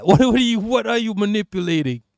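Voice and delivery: normal talking voice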